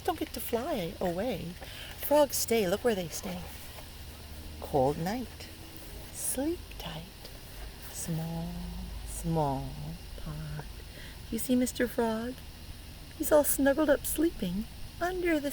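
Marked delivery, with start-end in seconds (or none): 4.6-10.7 whispered, fading softer; soft lullaby. Acted. Reading the winter rhyme to kids, hushed. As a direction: hush like a bedtime.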